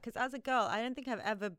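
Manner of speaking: usual speaking voice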